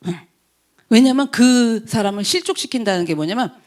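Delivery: normal talking voice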